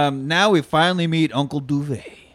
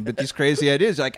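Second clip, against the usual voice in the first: ordinary delivery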